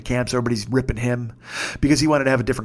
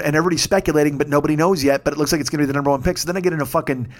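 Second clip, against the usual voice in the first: usual speaking voice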